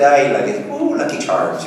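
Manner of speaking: hammed-up Irish brogue, leaping high; fake Irish accent. Actor mocking anyone who fakes Irish, hammed-up Irish accent. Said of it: "tries to do a fake Irish accent".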